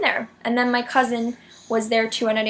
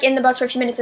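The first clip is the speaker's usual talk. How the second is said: ordinary talk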